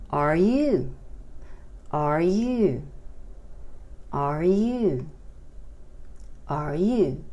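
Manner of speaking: "Are you?" repeated clearly, falling on "you"; model question. Shown as a demonstration. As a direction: drill "Are you?" for students. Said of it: "La pregunta sería".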